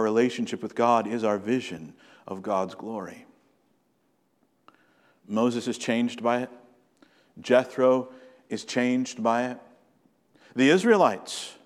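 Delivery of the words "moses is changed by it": usual speaking voice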